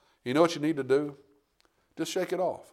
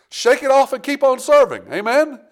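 high, loud exhortation; rally the church to keep serving; preaching call; 10 semitones higher, 6 dB louder than usual. Acted. Pastor urging the congregation, high and loud.

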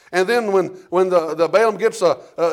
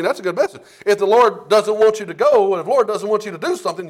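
ordinary talk

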